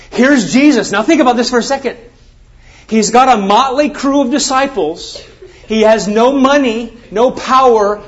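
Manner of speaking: high, loud, fervent preaching; preaching at full force. Acted. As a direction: drive it home to the congregation. Preacher builds to a climax.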